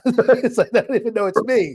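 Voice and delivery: laughing through the punchline; giggling while repeating the line. Real, not acted. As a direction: chuckle the words out, amused at yourself. Laughing at own joke.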